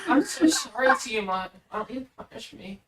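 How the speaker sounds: put-on high, squeaky anime girl. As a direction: coo it like a cutesy anime girl. Mocking Tiamat in girly voice.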